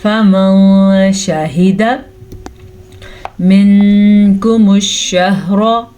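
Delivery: slow, measured tajweed chant; drawn-out Quranic recitation. Shown as a demonstration. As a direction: recite each syllable slowly and clearly. Recitation resumes.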